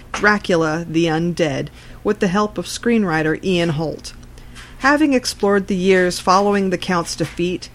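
ordinary delivery

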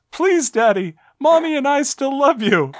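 put-on high-pitched little girl voice, pleading; little daughter. Daughter begging Daddy to come home, high and pleading. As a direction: put on a high, loud child's plea. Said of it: "Next, his daughter says".

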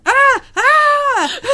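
high, loud mock cries of pain; hammed-up pained yells. [name] hit by a dart, hammed-up yelps. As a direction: yelp like he's been shot, playing it up.

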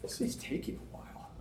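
whispered, bored self-talk. Retold or imitated. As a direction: breathe it out as a tired whisper. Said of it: "and I'm just like".

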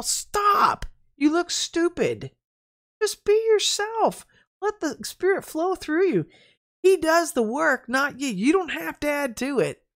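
high, slow, stretched-out admonishing; retold high-pitched pleading rebuke; exasperated scolding. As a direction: scold them in a high voice, drawing out each phrase. Retold scolding of showy church people.

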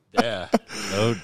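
normal talking voice

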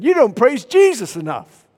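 put-on loud, high challenge as the old preacher; confronting prophet. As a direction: call him out loudly, imitating a prophet. Prophet rebukes him, acted.

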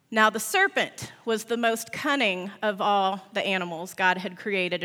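usual speaking voice

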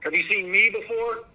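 high, coaxing question to a spirit; calling out to spirits. Real, not acted. Ghost hunter calling to spirits.

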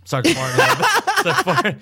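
high-pitched, breaking into laughter; laughing while talking. Real, not acted. Telling friend about dad's mishap, cracking up. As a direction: barely get it out for laughing. Teller cracks up at own story.

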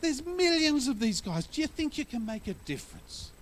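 high, condescending grown-up voice; scoffing man. Retold or imitated. Man on the beach scoffing at the boy, high and condescending. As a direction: talk down to the boy. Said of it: "He said".

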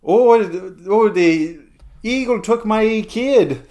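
high, loud hammed-up wail of a parent who lost a child; a parody wail, high and loud, like a tabloid tragedy; mock distraught parent. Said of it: "and then they'll say".

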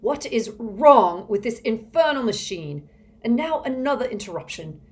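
loud, high irritated outburst; exasperated. A narrator's reading. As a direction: rail at yet another interruption. Villain rages at interruption.

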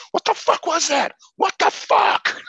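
raging friend in the car after the film; furious friend. Retold or imitated. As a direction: scream it in disbelief at the movie. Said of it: "he was so mad".

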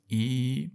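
ای sustained on one note; held ee. Shown as a demonstration. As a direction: stretch ای out, lips open. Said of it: "بگید ای". (ای کشیده و ممتد)

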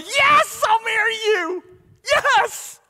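high, loud, thrilled squeal of a new fiancée; squealing yes. Retold or imitated. Angela accepting Matt's proposal, a high loud squeal. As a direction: shriek it out like she just got the ring. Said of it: "Angela squeals and goes".

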